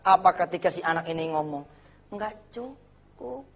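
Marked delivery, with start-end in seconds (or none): 2.1-3.5 mock whine. Mimicking the child's complaint.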